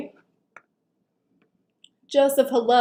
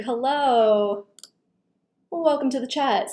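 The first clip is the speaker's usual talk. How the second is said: ordinary talk, nothing marked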